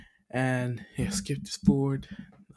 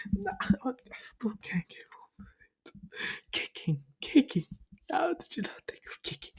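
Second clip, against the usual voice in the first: high, breathy, fast disbelief; can't believe it; incredulous; 13 semitones higher, 4 dB quieter than usual. Real, not acted. Disbelief about kicking.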